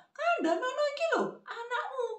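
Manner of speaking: high-pitched granny complaint on the phone; acted high, nagging grandma; complaining grandmother. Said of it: "dia langsung berkata".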